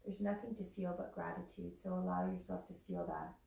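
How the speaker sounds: soft, unhurried meditation voice; guided meditation. Acted. Yoga teacher guiding students through Shavasana, soft meditation voice. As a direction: ease them gently into rest.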